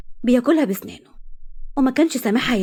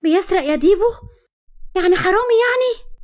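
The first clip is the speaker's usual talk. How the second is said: annoyed; 9 semitones higher, 4 dB louder than usual. Acted. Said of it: "حبيبة بغيظ".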